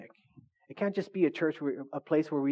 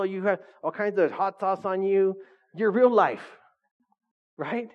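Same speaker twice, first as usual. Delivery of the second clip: ordinary talk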